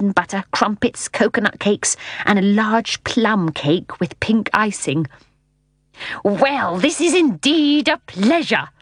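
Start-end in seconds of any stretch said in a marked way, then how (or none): 6.2-8.7 higher, slow, drawn-out greeting; huge hearty voice. A narrator's reading. Unseen host greeting his visitors, huge hearty voice. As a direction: boom a delighted welcome. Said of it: "A huge voice greeted them".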